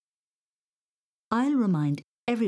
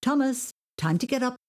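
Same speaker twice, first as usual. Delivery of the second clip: mam calling up the stairs, louder; mother calling a sleepy son, louder; 7 semitones higher, 4 dB louder than usual. Acted. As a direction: call him down from upstairs. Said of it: "his mam's voice call".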